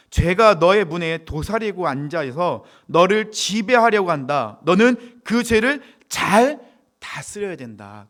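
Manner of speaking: loud, slow declaimed warning; preacher reading God's warning at full voice; scripture warning. Acted. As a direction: proclaim it with heavy, separated stresses. Reads God's warning aloud.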